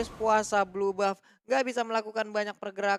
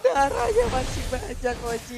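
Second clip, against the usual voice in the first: esports caster; 4 semitones higher, 4 dB louder, slower than usual. Real, not acted. Co-caster hyped play-by-play.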